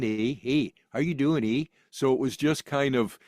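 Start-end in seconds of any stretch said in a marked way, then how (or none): 0.0-1.7 exaggerated Canadian accent. Shown as a demonstration. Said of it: "an American idea of a Canadian accent".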